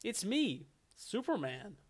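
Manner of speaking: lightly voiced generic American hero; Superman. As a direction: announce yourself as a stock American hero.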